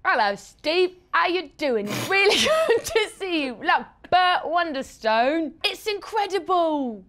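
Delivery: loud, high, drawn-out bad Cockney; badly faked Cockney, hammed right up; bad Cockney accent. Guest puts on a bad accent at the host's request, hammed-up Cockney. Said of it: "How about a really bad Cockney".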